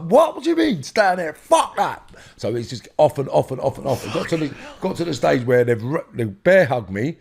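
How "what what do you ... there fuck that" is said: retold outraged protest. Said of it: "I'm like".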